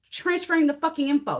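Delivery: usual speaking voice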